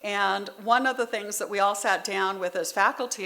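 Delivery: usual speaking voice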